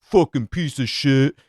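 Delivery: hammed-up bitter cursing; mock bitter. Podcaster showing how he didn't sound about Opie, mock bitter. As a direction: curse him in a put-on bitter voice. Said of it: "I wasn't bitter on that episode going".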